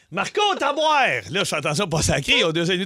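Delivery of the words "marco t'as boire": Marco lancé haut et fort; appel de jeu. Retold or imitated. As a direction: appelle les enfants, presque un sacre. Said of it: "jeu de piscine, presque sacre". (crie Marco, voix haute)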